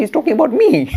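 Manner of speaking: high, loud, startled he's-talking-about-me; surprised realization. Retold or imitated. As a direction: caught out, half amused. Devotee realizes he is meant.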